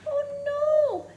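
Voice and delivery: high, loud storyteller's "oh no"; mock alarm. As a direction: react with exaggerated dismay for little listeners. Alarm at child on books.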